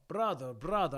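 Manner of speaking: put-on Russian accent. Podcast host playing a Moscow viewer, Russian accent. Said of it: "I can do a Russian accent".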